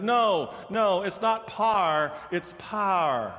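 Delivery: light Texan drawl, slow; mild Texan correcting; Texas accent. Retold or imitated. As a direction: stretch power into one syllable, par. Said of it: "Delegation in front of us was from Texas".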